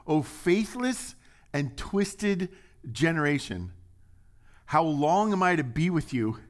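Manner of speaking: high, loud exasperated rebuke; rebuking Jesus. Acted. Preacher voicing Jesus rebuking the disciples, exasperated. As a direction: cry it out in frustration.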